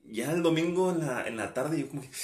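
ordinary talk, nothing marked

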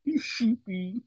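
odd high breathy mumble; weird creepy voice. Pretend. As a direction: say it in a strange creepy voice. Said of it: "stop talking like that".

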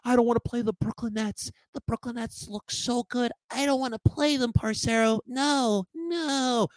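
whiny high mock fan, fretting; imitating scared fans who dread Brooklyn. Mocking scared fans to his viewers, whiny and high. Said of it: "I've been hearing a lot of".

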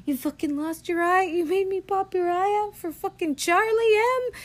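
high-pitched mock hysterical rage; mad and hysterical. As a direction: shriek it in disbelief, hamming up the fury. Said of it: "He gets so freaking mad and hysterical".

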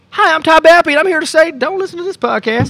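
high, loud announcer parody; high silly voice. Pretend. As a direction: pitch it up like a goofy ad. High ad-spot character voice.

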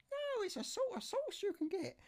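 niece's voice. Retold or imitated. Niece phoning her uncle for help, high falsetto. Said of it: "I've got wrong voice".

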